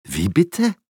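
high surprised question; taken aback, asking back. A narrator's reading. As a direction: react startled by the question. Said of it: "fragt der Vater erstaunt".

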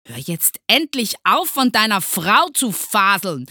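loud, high, fed-up teacher; angry yell. A narrator's reading. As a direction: yell at the pupil to stop. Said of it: "schrie Herr Groll". (wütend schreiend)

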